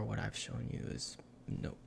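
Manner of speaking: normal talking voice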